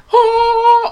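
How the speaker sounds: very high, loud drawn-out "ooh"; high hooting noise. Shown as a demonstration. Host showing how huge her hair was, high loud hoot.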